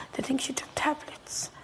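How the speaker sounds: whispered, no voice behind it; hushed whisper. Retold or imitated. Son telling his mother the cause, whispered. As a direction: say it under your breath, stunned. Son breaks news softly.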